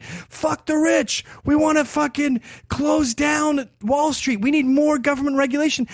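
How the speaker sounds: imitating a shouting Wall Street protester; mock protester shout. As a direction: ham up an angry Occupy protester. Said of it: "like even Occupy Wall Street".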